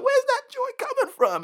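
very high, loud squeal of surprise; amazed high-pitched wondering; astonished question. Pretend. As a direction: marvel out loud. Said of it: "You're like".

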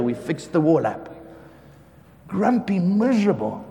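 normal talking voice

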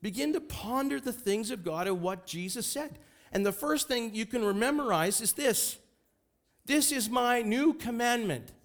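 high, ringing preacher's proclamation; full-force preaching. Real, not acted. Preacher giving the church the new commandment, impassioned proclamation.

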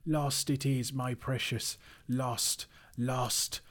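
high, anguished creature lament; slow keening over a lost treasure; wailing Gollum. A narrator's reading. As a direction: wail it out in misery. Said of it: "Bilbo heard him crying".

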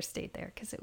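whispered aside. Real, not acted. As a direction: breathe it out, voiceless. Trailing aside.

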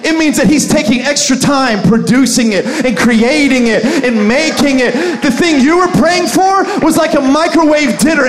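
loud rising preaching; full-force preaching. Acted. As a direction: build the sermon to a peak. Preaching climax.

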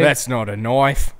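loud, slow mock-Australian, "knife" as "noife"; hammed-up Australian movie-line accent. Co-host showing off his best Australian accent. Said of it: "Is that our best Australian accent?".

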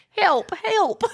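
put-on high, laughing cowboy accent. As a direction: squeak it like a tiny cowboy. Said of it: "where did that accent come from".

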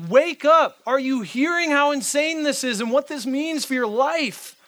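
high, loud urgent plea; wake-up shout. Pretend. Pastor to the congregation, a high loud wake-up cry. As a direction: shake them awake with your voice. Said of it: "shake you and be like".

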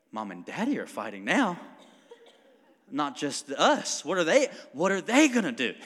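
put-on worried kids. Kids watching Mom and Daddy argue, alarmed and rising. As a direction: play the anxious child watching parents fight. Kids reacting to parents' argument.

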